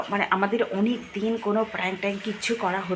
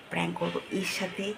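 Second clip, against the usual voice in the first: softer, hushed plotting; speaking softly; 3 semitones lower, 6 dB quieter than usual. Real, not acted. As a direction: keep it down while scheming. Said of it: "অনেকটা আস্তে আস্তে কথা বলছি".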